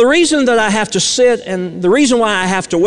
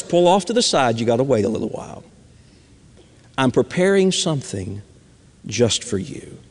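soft God voice; 8 semitones lower, 6 dB quieter than usual. Acted. God's voice in sermon illustration.

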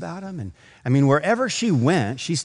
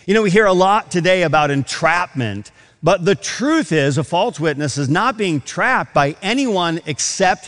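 a preacher pressing the point from the pulpit, louder; fervent preaching; 4 semitones higher, 4 dB louder than usual. Acted. Preacher warning the church about lying, in a fervent raised voice. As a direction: build it like a sermon climax.

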